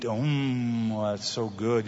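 low, soft murmur of relish; low, appreciative mealtime mumble; savoring food to oneself. Acted. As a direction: talk to yourself over a tasty bite. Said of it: "You're talking to yourself about it".

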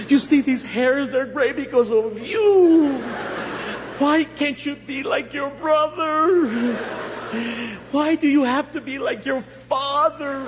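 high, drawn-out guilt-tripping mom; hammed-up reproachful mama; scolding mother. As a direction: lament like a long-suffering mother, stretching the last words. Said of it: "she would tell me things like".

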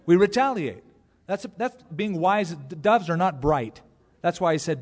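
ordinary talk, nothing marked

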